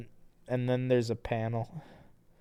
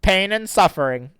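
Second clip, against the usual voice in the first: character voice; 10 semitones higher, 10 dB louder than usual. Acted. Reading the dedication in voice.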